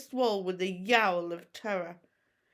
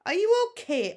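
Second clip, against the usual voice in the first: higher, lightly voiced worried friend; worried boy; 12 semitones higher, 8 dB louder than usual. A narrator's reading. Fishlegs checking on Hiccup, lightly worried. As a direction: ask with concern. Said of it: "asked Fishlegs".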